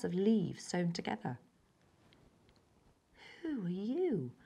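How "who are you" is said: softer, faltering ask; weak question. A narrator's reading. Frightened child facing a stranger, weak voice. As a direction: say it weakly, unsure. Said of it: "I said weakly".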